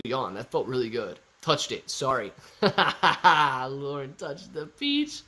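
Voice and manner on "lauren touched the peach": drawn-out words through laughter; amused, slow, laughing exclamation. Real, not acted. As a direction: laugh as you announce the mishap. Laughs at viewer touching the peach.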